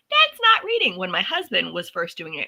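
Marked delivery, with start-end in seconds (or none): 0.1-1.0 high, loud mock scolding; mocking old self. Wife objecting to husband's audiobooks, high self-mocking voice. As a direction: imitate your own snobby objection, pitched way up. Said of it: "I was the one who said".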